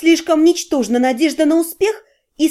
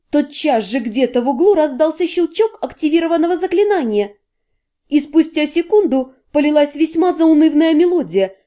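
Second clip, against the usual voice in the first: ordinary delivery, nothing marked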